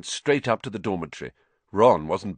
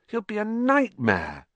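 lightly voiced fussy wizard; 12 semitones higher than usual. Character voice before chapter text.

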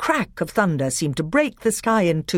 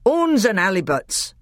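a higher, louder dwarf oath; dwarf exclaiming; 7 semitones higher, 4 dB louder than usual. Acted. Red dwarf scolding the sour dwarf, exclaiming loudly. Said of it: "exclaimed the third voice".

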